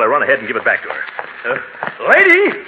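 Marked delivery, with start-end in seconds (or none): 2.1-2.6 calling out. Acted. Calling after woman down street.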